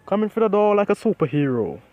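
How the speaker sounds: loud, high superhero announcement; heroic proclamation. A narrator's reading. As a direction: proclaim it grandly to the whole class. All Might makes his grand entrance.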